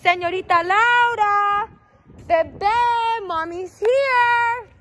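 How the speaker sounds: singsong holler, mommy's here; sing-song calling. Acted. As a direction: croon her name across the lot.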